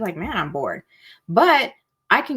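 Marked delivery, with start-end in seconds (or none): 0.2-0.8 low, soft bored grumble; acted boredom. Coach voicing a low-intensity negative, mildly acted boredom. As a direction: play bored, sinking down in pitch and volume. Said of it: "It don't feel good".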